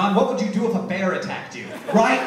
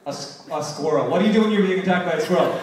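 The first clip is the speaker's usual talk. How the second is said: ordinary talk, nothing marked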